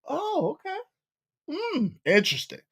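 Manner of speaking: soft, hushed mock interest; quietly intrigued. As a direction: murmur it like a polite listener. Acting out a listener hearing Joe out.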